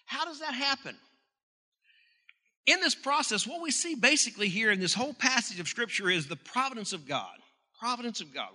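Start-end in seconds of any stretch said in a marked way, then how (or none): 2.6-8.5 preaching emphasis. Acted. Preacher builds to providence.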